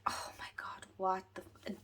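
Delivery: breathless shocked whisper; mock shocked whisper. Whispering to viewers, mock shocked at a twist. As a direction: whisper it like the book just floored you. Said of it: "Was I kinda like".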